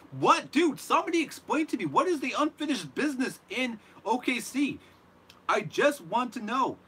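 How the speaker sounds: high, loud disbelieving cry; incredulous outburst. Real, not acted. Vlogger asking viewers what unfinished business means, loud and incredulous. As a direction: demand an answer in loud disbelief. Incredulous rant at Paul George.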